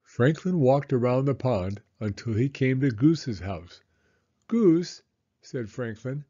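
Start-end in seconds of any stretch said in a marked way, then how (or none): 4.5-5.0 high, loud call to a friend's door; young turtle calling at the door. Acted. Franklin calls at Goose's house.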